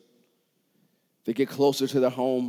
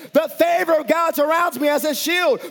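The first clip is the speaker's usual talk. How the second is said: high, loud declarations; a preacher declaring God's favor over himself; preaching at full force; 13 semitones higher, 4 dB louder than usual. Acted. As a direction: shout the confessions with fervour.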